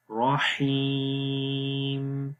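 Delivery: slow رحیم with a very long 'ee'; lengthened yaa. Shown as a demonstration. Teacher showing the long vowel, رحیم drawn out. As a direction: hold the second vowel of رحیم. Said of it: "تو یہاں پر بھی لمبا کریں گے".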